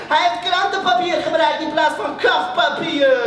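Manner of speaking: high, loud jeering announcement; tattletale's shout. Acted. Class rat exposing Ali's newspaper covers, jeering shout. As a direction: broadcast his shame to the room. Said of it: "die liet er geen gras over groeien en riep".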